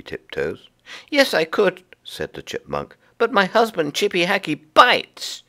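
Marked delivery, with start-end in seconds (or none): 1.1-1.8 very high, indignant chipmunk wife; high upset chipmunk wife; Mrs Chippy voice. Acted. Chipmunk answers. 3.2-5.4 very high, indignant chipmunk wife; high upset chipmunk wife; Mrs Chippy voice. Acted. Chipmunk warns.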